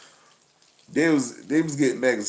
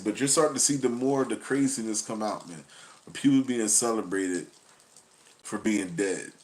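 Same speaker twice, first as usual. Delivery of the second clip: usual speaking voice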